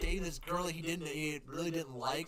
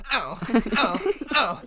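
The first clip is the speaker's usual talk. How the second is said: loud mock yelps of pain; hammed-up pained cries; 5 semitones higher, 12 dB louder, slower than usual. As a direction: yelp ow like a twitching character. Mocking a twitching comic bit.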